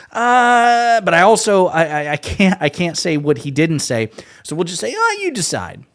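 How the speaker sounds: a hint of a panicked host, voice jumping high; flustered mock host. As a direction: stammer like a caught host. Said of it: "He's like".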